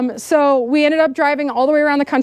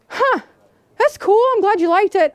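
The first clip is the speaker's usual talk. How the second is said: mock surprised; 8 semitones higher than usual. Voicing REI staff on the phone.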